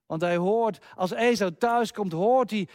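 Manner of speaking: ordinary talk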